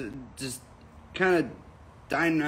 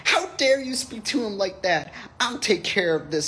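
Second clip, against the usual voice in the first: high, raised mock-outraged manager voice; indignant scolding; 11 semitones higher, 4 dB louder than usual. Manager scolding over the joke, in mock outrage. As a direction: act out an indignant telling-off, pitched up.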